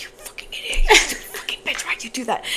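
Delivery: hissed self-insult, half laughing; self-scolding. Retold or imitated. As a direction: curse at yourself under breath. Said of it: "going up the stairs like".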